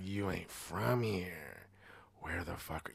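low, soft, slow mock menace; suspicious challenge. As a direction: play the local sizing up an outsider, voice dropped. Suspicious locals confronting a newcomer.

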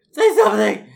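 high, loud demand; shouting. Pretend. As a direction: yell it into the dead line. Mock calling into silent line.

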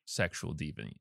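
ordinary delivery

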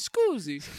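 playful fake Italian as an ancient Roman; mock Italian accent. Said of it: "Kind of Italian".

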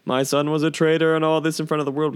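hint of a declaration to a crowd; mock public denouncement. Imagined father disowning his son before the world, mock proclaiming.

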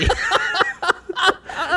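squealing laugh breaking through; high burst of laughter. Real, not acted. Bursts out laughing mid-rant.

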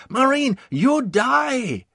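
put-on high, loud, slow taunt in accented English; accented enemy taunt. A narrator's reading. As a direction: mimic the accented threat, drawn out and shrill. Mimics the enemy's taunt again.

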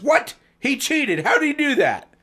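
high, loud outraged squawk; furious disbelieving yelp; irate. Acted. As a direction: shriek it like a cheated sore loser. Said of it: "became irate".

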